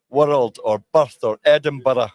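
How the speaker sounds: "world", "birth", "Edinburgh" with a hard tapped r; tapped Scottish r. Shown as a demonstration. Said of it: "a harder sort of tap".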